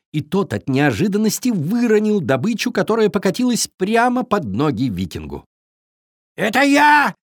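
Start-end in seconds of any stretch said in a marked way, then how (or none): 6.4-7.1 loud, high raven squawk; cawing raven. A narrator's reading. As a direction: caw it out at full pitch. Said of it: "закаркал ворон".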